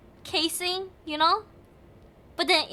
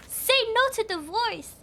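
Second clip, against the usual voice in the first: ordinary delivery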